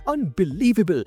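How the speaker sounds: high startled exclamation. A narrator's reading. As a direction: react in disbelief. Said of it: "startled voice".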